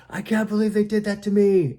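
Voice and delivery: high, loud indignant outburst; angry. Acted. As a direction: squeal it out in outrage. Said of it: "if you're angry".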